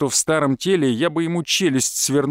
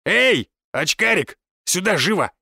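higher, commanding thug's holler; calling out; 4 semitones higher than usual. A narrator's reading. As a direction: summon him roughly across a crowd. Thug calls someone out of crowd. (повелительный окрик)

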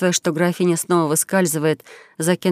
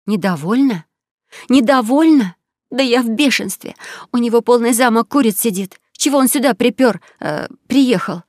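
furious; 5 semitones higher than usual. A narrator's reading. Countess raging at the king about the duke, furious. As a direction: explode with outrage.

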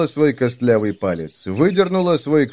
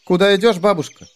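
put-on bright girl voice; 8 semitones higher, 4 dB louder than usual. Heroine asks old woman.

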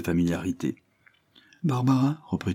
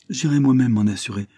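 exclaiming; 5 semitones higher, 5 dB louder than usual. A narrator's reading. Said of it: "s'écria Isabelle".

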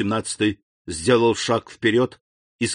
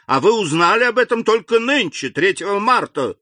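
outraged; 8 semitones higher, 3 dB louder than usual. Acted. King outraged at the late news.

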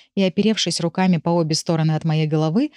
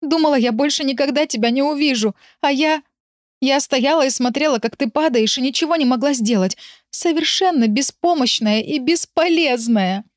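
speaking through sobs; 7 semitones higher than usual. Acted. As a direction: sob out the despair. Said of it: "пытаясь говорить сквозь рыдание".

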